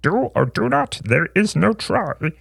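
slow, ancient little master; Yoda's words in an odd high voice. A narrator's reading. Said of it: "Yoda's ancient words".

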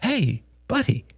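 high-pitched, gentle coaxing; calm a crying child tenderly; soft-edged comforting greeting; soothing father. Acted. Father soothes his crying son.

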